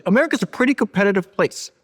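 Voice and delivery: imitating an emphatic, high-pitched pitch; retold rallying cry, lifted high; rallying argument. Host quotes Elon on America, rallying.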